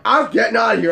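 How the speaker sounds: loud, high mock protest; acted-out frantic complaint; retold exasperated outburst. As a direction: burst out as you flee, exasperated. Retold outburst while fleeing the song.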